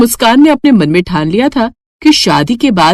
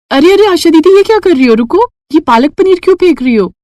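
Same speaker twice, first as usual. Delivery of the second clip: put-on surprised young bride; 7 semitones higher than usual. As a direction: stop the maid mid-act in surprise. Muskan stops the maid. (चौंकी हुई बहू, ऊँची आवाज़ में)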